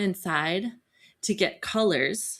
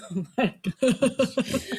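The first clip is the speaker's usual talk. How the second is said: slow, laughing oh my gosh, pitched up; half-laughing panic as the police pull up; laughing dismay; 7 semitones higher, slower than usual. Retold or imitated. Mother seeing police arrive.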